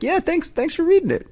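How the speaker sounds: tiny high-pitched thank-you; mock timid squeak. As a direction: imitate yourself squeaking thanks to a hero. Retells own starstruck reply to host.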